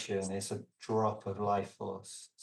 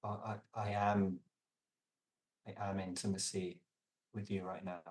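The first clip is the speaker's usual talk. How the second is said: soft intimate confession; 6 dB quieter than usual. Shown as a demonstration. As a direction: say it gently from longing, close to them. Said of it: "it's like".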